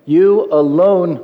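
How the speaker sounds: "alone" slow and emphatic; deliberate emphasis landing on "alone"; stress on alone. Shown as a demonstration. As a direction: stretch out "you alone" with firm weight on "alone". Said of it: "emphasis on the word alone".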